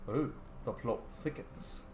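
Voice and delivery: ordinary delivery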